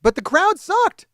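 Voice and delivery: high, loud, slow blast; real frustrated yell; angry complaint. Real, not acted. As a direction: spit out sucked in anger. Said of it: "I'm too fucking mad".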